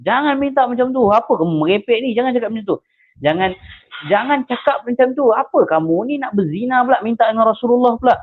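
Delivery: a retold crowd scolding the young man, mock angry. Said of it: "semua orang datang kat dia dan marah dia".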